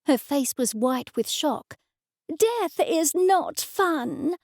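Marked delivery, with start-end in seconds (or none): 2.3-4.4 slow, high, scandalised mother; indignant mother. A narrator's reading. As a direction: scold it slowly and firmly. Shocked mother scolding.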